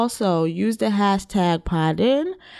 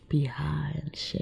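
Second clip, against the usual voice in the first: whispered; 3 semitones lower, 8 dB quieter than usual. Real, not acted. Relaxed confession, soft aside.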